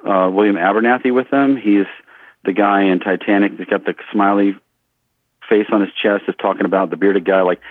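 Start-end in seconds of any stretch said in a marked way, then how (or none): none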